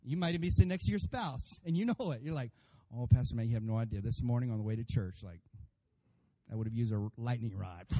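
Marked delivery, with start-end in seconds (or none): 3.0-7.8 lightly acted low-pitched aside; confiding churchgoer. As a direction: lower your pitch, weary mock confession. Acted spouse confiding to pastor.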